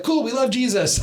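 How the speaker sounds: high, bright-eyed overeager quote; eager cool reply. Retold or imitated. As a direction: play it overly keen and upbeat. Awkward eager reply to stranger.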